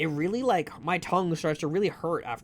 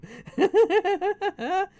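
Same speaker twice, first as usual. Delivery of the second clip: loud high laughter; high squealing laugh; 14 semitones higher, 6 dB louder than usual. Real, not acted. Laughing again.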